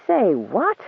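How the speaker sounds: high, incredulous "say what?"; can't believe what he just heard; disbelieving. Acted. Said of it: "not believing what he heard".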